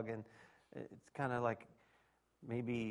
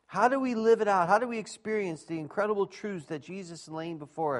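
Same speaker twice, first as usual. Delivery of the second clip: higher, louder sermon voice, driving the point home; preaching at full force; 5 semitones higher, 5 dB louder than usual. Acted. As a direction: preach it out to the room, raised and ringing. Pastor preaching to congregation.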